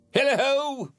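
very high, drawn-out holler; jovial cry. A narrator's reading. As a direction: whoop it out like a merry old master. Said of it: "cried old Fezziwig".

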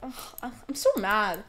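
ordinary delivery

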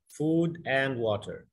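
"Food and water" said very slowly, each word drawn out; slow teaching demo. Shown as a demonstration. Teacher to Arabic-speaking learner, very slow model phrase. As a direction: spell it out slowly in sound, word by word. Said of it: "هتلاقي معناها food and water".

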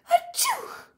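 high loud fake sneeze; big sneeze. Papa sneezes.

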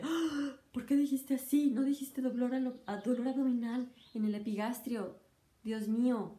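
high, scandalized mock complaint; mocking fussy doctor. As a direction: play the shocked pedant who hates plain words. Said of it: "y nadie dice". (burla de médico escandalizado, más agudo)

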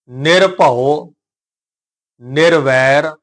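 very slow, drawn-out recitation, pausing after each term; careful recitation demo. Shown as a demonstration. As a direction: recite each term separately with long held vowels. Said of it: "ਇਹਦਾ ਉਚਾਰਨ ਆਪਾਂ ਥੋੜ੍ਹਾ ਜਿਹਾ ਹੁਣ ਸਮਝਣ". (ਹੌਲੀ, ਲਮਕਾ ਕੇ ਸ਼ੁੱਧ ਉਚਾਰਨ)

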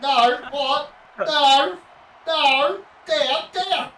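high, loud frantic protest; panicked yelling. Real, not acted. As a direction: yell in disbelief at the screen. Panicking at game action.

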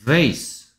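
ordinary talk

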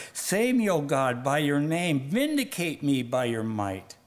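emphatic plea, high bursts falling away; fervent prayer. Acted. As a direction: declaim David's prayer to God. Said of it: "David prayed".

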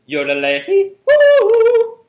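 slow booming yodel call. Shown as a demonstration. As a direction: belt the yodel even harder. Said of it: "Even louder".

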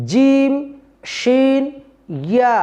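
জিম, শিন, ইয়া each separate and distinct; letters named clearly. Shown as a demonstration. Teacher demonstrating the letters, distinct. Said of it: "যুক্ত হয়ে".

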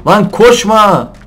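slow, loud high yell; long loud plea to stop; drawn-out shout. Real, not acted. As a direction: stretch the shout out after it. Deer running away.

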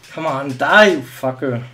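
loud spike on die; frustrated cursing. Real, not acted. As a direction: urge the enemy to die, exasperated. Cursing an enemy while shooting.

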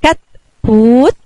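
drawn-out modelling pace; careful slow spelling aloud; slow syllable reading. Shown as a demonstration. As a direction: stretch each syllable for the kids. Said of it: "يلا نقرأ مع بعض".

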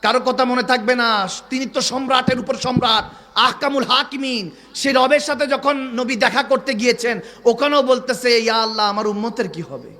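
impassioned, booming sermon; full-force preaching. Acted.

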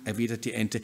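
ordinary delivery, nothing marked

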